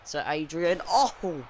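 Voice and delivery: ordinary delivery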